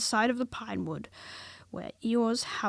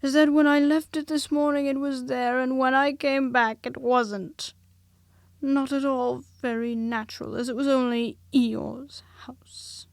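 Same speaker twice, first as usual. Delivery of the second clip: lightly voiced gloomy Eeyore; melancholy voice; 4 semitones higher, 8 dB louder than usual. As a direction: moan it out as if nothing good ever happens. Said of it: "in his most melancholy voice".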